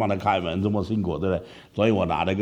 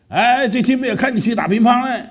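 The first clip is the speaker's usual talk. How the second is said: much higher, bright teasing call; pastor's friendly greeting; 14 semitones higher, 5 dB louder than usual. Retold or imitated. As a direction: pastor pretends casual concern. Pastor greets him on a visit. (牧师高声打招呼)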